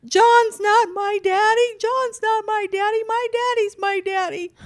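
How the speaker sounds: high, loud wailing protest; crying child. Retold or imitated. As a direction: wail it like a sobbing little boy.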